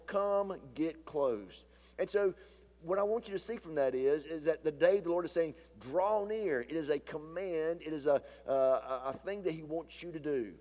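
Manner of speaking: ordinary talk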